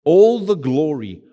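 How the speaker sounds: slow, swelling preacher's call; closing a sermon, preaching slowly with full force. Acted. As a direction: drive each phrase home from the pulpit.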